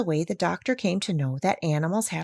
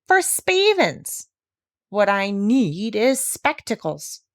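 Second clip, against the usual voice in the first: higher, slow complaining horse voice; plough horse; 5 semitones higher, slower than usual. Acted. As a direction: grumble like an old horse.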